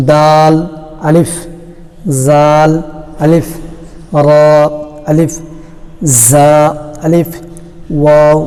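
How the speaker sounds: long level letter name, then short "Alif"; letter recitation. Shown as a demonstration. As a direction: intone each pair slowly for learners.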